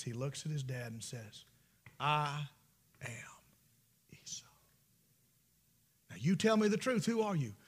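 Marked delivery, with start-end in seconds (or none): 2.0-4.5 quiet lie. Acted. Jacob lying again.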